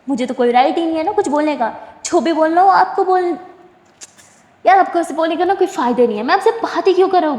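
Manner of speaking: fast, loud, rising angry rant; angry outburst. Retold or imitated. Sonu shouting at Suhana to leave his car, angry and fast.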